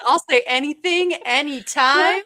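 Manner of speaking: exaggerated mocking boast; mock bold declaration. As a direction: proclaim it loudly, mimicking a shameless friend. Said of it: "He's like".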